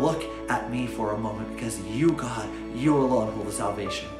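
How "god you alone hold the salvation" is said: ordinary talk, nothing marked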